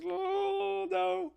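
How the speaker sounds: squeaky high falsetto oh no; mock dismay. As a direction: jump way up into a cartoonish voice of dread. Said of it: "you're like".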